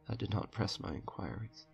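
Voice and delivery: ordinary delivery, nothing marked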